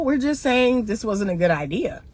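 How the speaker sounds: ordinary talk, nothing marked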